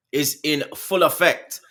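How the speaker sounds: ordinary talk, nothing marked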